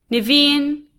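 calling from another room. A narrator's reading. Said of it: "she calls again".

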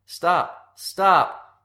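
high, urgent, repeated shouts; repeated yell. A narrator's reading. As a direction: keep yelling to break up a fight. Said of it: "I yelled and yanked on their shirts".

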